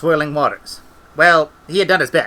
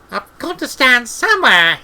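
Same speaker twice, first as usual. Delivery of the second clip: protesting, much higher voice; 9 semitones higher, 4 dB louder than usual. A narrator's reading. Treatle answering the wizard's rebuke, high protest. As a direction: object in a high character voice.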